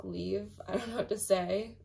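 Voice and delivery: ordinary talk, nothing marked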